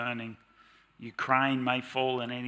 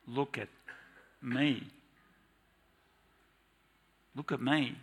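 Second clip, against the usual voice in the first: softer, gentle and unhurried; calm invitation; 4 dB quieter than usual. Acted. Jesus calmly inviting you and I, soft and gentle. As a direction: invite them quietly.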